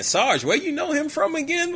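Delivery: acted high-pitched question climbing at the end; puzzled questioning. Uneasy soldier asking Sarge about the crazy guy, high and puzzled. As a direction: voice shooting up in mock bewilderment.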